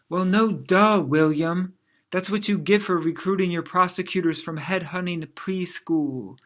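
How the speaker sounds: sarcastic, emphatic put-down from a spouse; mock exasperated wife. Wife scolding husband over dinner, mock exasperated. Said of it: "Attorney General Barr's wife had this to say".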